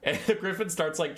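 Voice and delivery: ordinary talk, nothing marked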